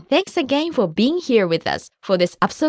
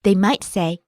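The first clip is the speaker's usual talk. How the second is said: ordinary talk, nothing marked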